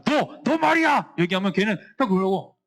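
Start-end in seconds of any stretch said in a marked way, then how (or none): 0.0-1.1 high, sharp call-out at a pupil; angry teacher. Acted. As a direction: act out a teacher singling someone out in anger. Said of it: "선생님이 화가 나고".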